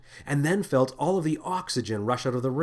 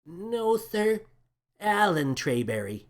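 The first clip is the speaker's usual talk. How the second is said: higher, politely insistent; timid crewman; 7 semitones higher, slower than usual. A narrator's reading. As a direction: meekly correct him again.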